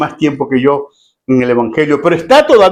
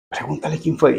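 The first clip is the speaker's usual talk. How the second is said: furtive prompt; 8 dB quieter than usual. Acted. Pedro secretly prompting Juan.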